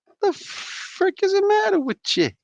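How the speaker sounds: cartoonish disbelief; hammed-up indignation at the packaging; squeaky mock outrage. Real, not acted. Peak of rant over tape.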